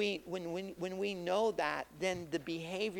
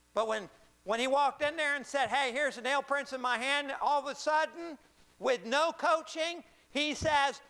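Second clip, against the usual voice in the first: higher-pitched, punchy preacher delivery; high-energy pulpit storytelling; animated preaching; 7 semitones higher, 5 dB louder than usual. Acted. Preaches at full force.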